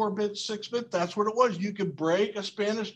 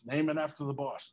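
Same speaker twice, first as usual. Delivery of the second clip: put-on advice to kids; 6 semitones lower, 5 dB quieter than usual. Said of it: "I always tell my kids".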